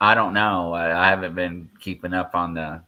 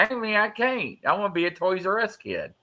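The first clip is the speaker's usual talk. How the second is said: put-on high, softer kid-like whine; mock childish protest; 10 semitones higher, 4 dB quieter than usual. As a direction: play a kid refusing to grow up. Joking refusal to grow up.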